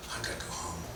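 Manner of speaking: very soft, deflated mock aside; hushed retold resignation; defeated aside. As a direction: drop it almost to nothing, as if defeated. Retold deflated aside after being mocked.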